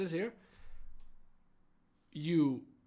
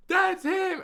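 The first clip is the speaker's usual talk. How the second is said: high, loud pointing shout; high loud cry; excited call; 6 dB louder than usual. Retold or imitated. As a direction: call out to the others, pitch jumping up. Girl points him out.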